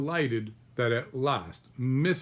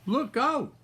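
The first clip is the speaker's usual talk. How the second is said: much higher, louder duck squawk; 14 semitones higher, 4 dB louder than usual. Acted. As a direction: cry out a sharp warning like a startled duck. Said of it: "squawked Missus Mallard".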